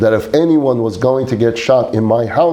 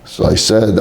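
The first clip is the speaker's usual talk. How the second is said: normal talking voice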